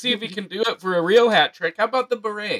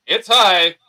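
home run call; 7 dB louder than usual. Pretend. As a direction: call it like a ballpark announcer.

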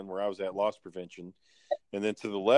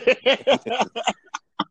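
burst of laughter; 14 semitones higher, 9 dB louder than usual. Real, not acted. Laughing at own joke.

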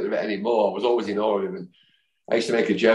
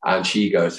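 usual speaking voice

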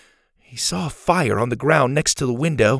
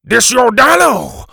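high, loud, drawn-out shout; slow, outraged bellow; furious shout; 10 semitones higher, 9 dB louder than usual. A narrator's reading. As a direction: roar it like an enraged teacher. Furious teacher confronts the boy.

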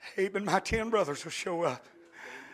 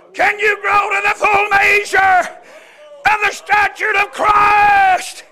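loud, drawn-out preacher's challenge; full-force preaching; 13 semitones higher, 16 dB louder, slower than usual. Acted. Preacher challenging the congregation to grow, full-force preaching. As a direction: call them up to grow.

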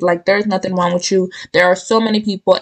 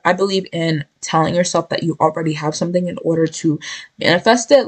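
ordinary delivery

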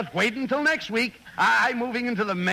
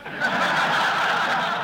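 a short unvoiced laugh; breathy laugh. Real, not acted. Straight man laughing at the comic's joke. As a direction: laugh under the breath at the gag.

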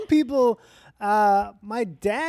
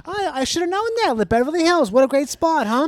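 loud, higher Massachusetts relative voice, laughing; family impression; 8 semitones higher, 7 dB louder than usual. Guest does her family back east, gushing over Beverly Hills. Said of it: "Just jump into it".

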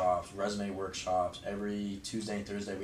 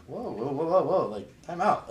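mock alarm; 8 semitones higher, 7 dB louder than usual. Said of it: "And I'm like".

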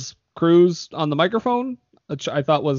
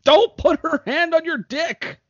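high mock scold; 13 semitones higher than usual. Voicing character's scolding.

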